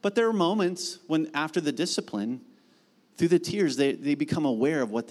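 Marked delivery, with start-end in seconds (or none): none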